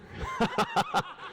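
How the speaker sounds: a quick, high burst of laughter; short laugh. Real, not acted. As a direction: laugh it off after the joke. Laughs after teasing Josh.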